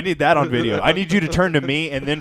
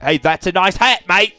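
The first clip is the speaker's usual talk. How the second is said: put-on loud Australian accent, pitched up; cheery Australian bloke greeting; 6 semitones higher, 6 dB louder than usual. Friend showing off an Australian accent, loud and cheery. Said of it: "I need to do the Australian accent".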